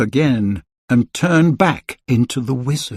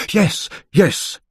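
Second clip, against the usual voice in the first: hurried; 6 semitones higher than usual. A narrator's reading. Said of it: "he said hurriedly".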